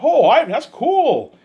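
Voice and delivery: very high, loud and slow mock delight; impressed Peter. Peter sees the picture, slow high mock delight. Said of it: "He's like".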